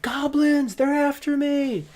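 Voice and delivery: high, loud panicked yell; drawn-out frantic scream. Acted. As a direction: cry out in mock terror. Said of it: "you keep screaming there".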